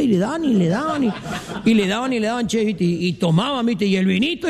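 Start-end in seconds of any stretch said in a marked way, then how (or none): none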